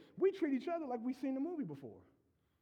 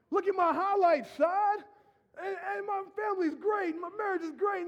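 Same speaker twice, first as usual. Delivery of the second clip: higher, louder boastful show-off; mock bragging; 7 semitones higher, 5 dB louder than usual. Churchgoer bragging to the pastor, loud and high. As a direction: brag it big to the pastor.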